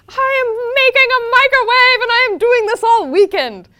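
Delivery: high, loud gleeful announcement; excited child. Retold or imitated. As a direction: shout it like an eager kid. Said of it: "a child again".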